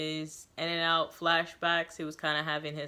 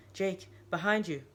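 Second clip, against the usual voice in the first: urgent warning; 3 semitones higher than usual. A narrator's reading. Narrator warning his brother, alarmed. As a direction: call out in alarm.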